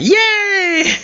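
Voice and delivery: high squeal of joy; sudden high happy squeal; excited yay. Shown as a demonstration. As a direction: burst up into a bright excited yay. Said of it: "my voice goes up".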